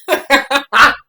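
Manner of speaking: high, loud burst of laughter; cracking up hard. Real, not acted. Vlogger cracking up at the vegan joke, loud laugh.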